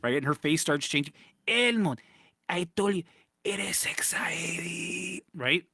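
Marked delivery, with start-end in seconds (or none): 1.5-5.2 high call, then a slow, unvoiced demonic whisper; demon mom whisper. Mom turning into a demon, calling her son in a slow creepy whisper. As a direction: hiss the Spanish words slowly like a possessed mother.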